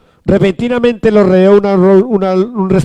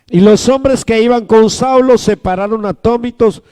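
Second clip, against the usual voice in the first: usual speaking voice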